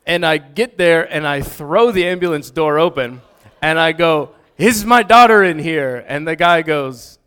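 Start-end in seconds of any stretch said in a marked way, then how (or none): 4.6-6.0 loud, higher-pitched frantic demand; frantic retold yell; urgent shout. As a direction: call out urgently, acting out the panicked dad. Bursts into ambulance looking for daughter.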